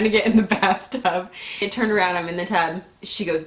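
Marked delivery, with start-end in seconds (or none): none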